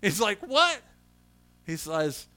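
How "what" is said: high-pitched incredulous question; imitated astonished "what"; baffled surprise. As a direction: react in disbelief. Man baffled by resting pins.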